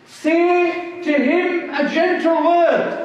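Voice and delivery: slow, lifted preacher emphasis on "gentle word"; slow emphatic. Shown as a demonstration. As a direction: Say to him a gentle word drawn out slow and pitched high. Preacher stresses the divine command.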